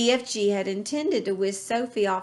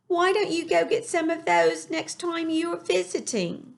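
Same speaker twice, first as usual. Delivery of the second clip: put-on much higher young girl voice; Sophie voice; 9 semitones higher than usual. A narrator's reading. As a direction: speak as an earnest little girl. Sophie figure in story.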